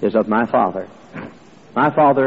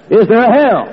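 much higher, louder insistent question; emphatic shout; 10 semitones higher, 5 dB louder than usual. Retold or imitated. Preacher pressing the young man, near shouting. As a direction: push it out hard.

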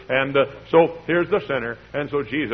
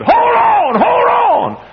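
very high shouted command; mock shout; 9 dB louder, much slower than usual. Another church lady at the altar hollering, shouting. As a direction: yell it at him over the crowd. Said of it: "another lady came by and said".

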